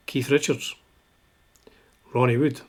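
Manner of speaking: ordinary delivery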